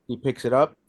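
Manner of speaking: ordinary delivery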